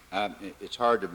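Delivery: ordinary talk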